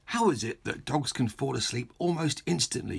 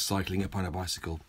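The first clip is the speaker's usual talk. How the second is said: ordinary delivery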